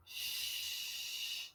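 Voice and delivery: soft, long voiceless "Shh"; quiet sustained "Shh"; isolated sound. Shown as a demonstration. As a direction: hold out the "Shh" hiss. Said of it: "I hear shh".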